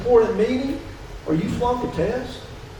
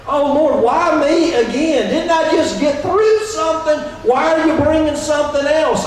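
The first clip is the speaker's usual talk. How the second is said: higher, mock-exasperated "why me again"; whiny complaint; 7 semitones higher, 3 dB louder than usual. Pastor mimicking a complaining believer, mock whine. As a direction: play the fed-up believer whining to God. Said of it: "it's like".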